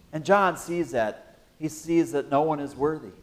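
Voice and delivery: ordinary delivery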